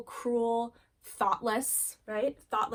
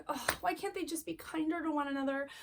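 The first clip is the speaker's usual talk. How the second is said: higher-pitched exasperated lament; disheartened frustration; 6 semitones higher than usual. Acted. Parent despairing over squabbling kids, exasperated. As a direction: plead in weary frustration, pitched up. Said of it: "mad or irritated or frustrated, disheartened, just so down".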